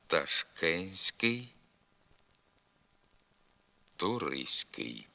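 slow word drill, no т before с in words like Агентство, гігантський; silent т. Shown as a demonstration. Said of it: "Вимовляйте без звука Т слова".

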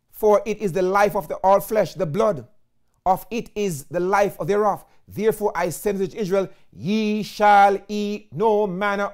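solemn scripture reading. Acted. Reading Leviticus with weight.

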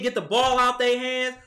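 ordinary talk

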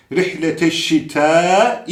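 "şitâ" with a very long held a; long vowel. Shown as a demonstration. Quran teacher modelling recitation, final vowel held long. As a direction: slowly stretch out the end of "şitâ". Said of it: "diye okuyacağız".

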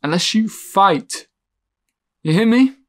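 urgent plea. Acted. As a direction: demand they fight back. Urging someone to fight.

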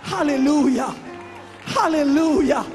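ordinary delivery

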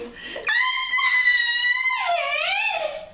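very high squealing cry; Baby Bear screams. Acted. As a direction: wail like a dramatic little bear. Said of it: "Baby Bear screams".

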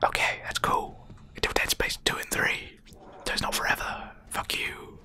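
hushed whisper, barely voiced. Real, not acted. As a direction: whisper it under your breath. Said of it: "I don't know why I'm whispering".

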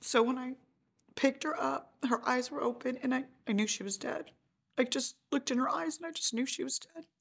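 strained, near crying as she relives it; real grief breaking through the telling. Real, not acted. Mother recounts finding daughter dead.

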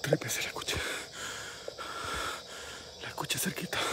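hushed, low whisper; real whisper. Real, not acted. Ghost hunter to viewers after hearing something close, whispering. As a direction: whisper it, barely any voice. Hearing La Llorona nearby at night.

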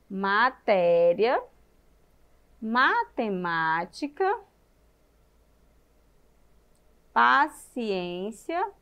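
drawn-out, slow words with lifted endings; slow word listing. Shown as a demonstration. Teacher recalling the accented words for children, slow and drawn-out. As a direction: list each word slowly, leaving it hanging.

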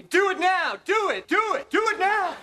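high mock-screamed command, repeated urgently; imitated screaming. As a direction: imitate someone screaming orders, high-pitched. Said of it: "Joy kept screaming at me".

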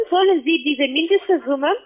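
ordinary talk, nothing marked

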